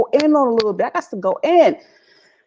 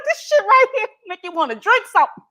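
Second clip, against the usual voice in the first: exasperated quip; 10 semitones higher than usual. Retold or imitated. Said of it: "I was just like".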